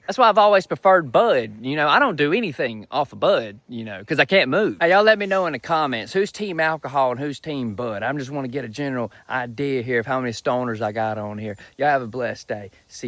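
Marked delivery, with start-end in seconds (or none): none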